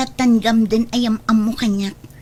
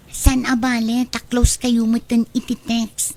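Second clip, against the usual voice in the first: usual speaking voice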